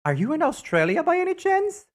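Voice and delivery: squeaky, stunned asking; high incredulous question. Real, not acted. Reacting to viewer up at four AM, high disbelief. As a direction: shoot the pitch way up in disbelief.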